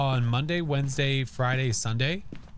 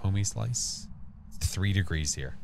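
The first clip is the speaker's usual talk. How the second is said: normal talking voice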